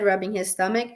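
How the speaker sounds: plain reading voice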